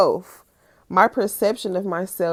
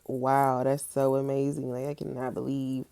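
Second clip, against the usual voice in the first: mock amazement; 3 semitones lower, 7 dB quieter than usual. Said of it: "I acted like".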